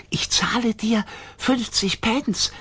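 high, slow, whispered offer; whispery creature voice. A narrator's reading. Gigglerin bribing Rover, high slow whisper. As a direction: whisper the bribe in a tiny high voice.

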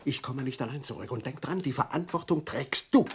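hushed urgency. Acted. Secret phone call continues.

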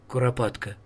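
ordinary delivery

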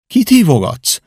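a sister calling across; Nan asking. Acted. Nan asks Tom who he is calling.

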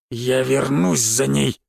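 slow low menacing growl. A narrator's reading. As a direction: growl a promise back. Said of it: "прорычал он".

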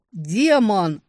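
loud frightened shout; frightened cry. Acted. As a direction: cry out in fear. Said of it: "испуганный возглас".

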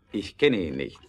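ordinary talk

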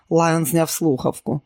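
ordinary delivery

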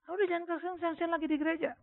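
high-pitched mock exasperation; retold annoyed protest. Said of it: "oh, saya paling marah".

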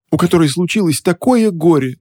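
high, hammed-up wail of grief; mock sobbing. A narrator's reading. Witch fake-crying to the clerk about her grief. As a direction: fake a sob on the words. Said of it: "она всхлипнула".

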